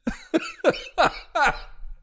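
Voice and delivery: high, breathy wheezing laugh; breathless fit of giggles; helpless laughter. Real, not acted. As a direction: crack up, wheezing with little voice. Cracks up after the clip.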